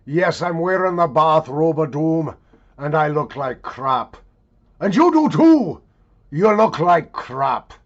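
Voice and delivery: big mock-grand proclamation; hammed-up show opener. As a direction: holler it like a bombastic show intro. Mock-grand show opening, hollered.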